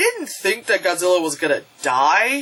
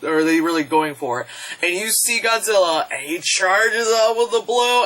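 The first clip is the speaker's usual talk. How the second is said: ordinary talk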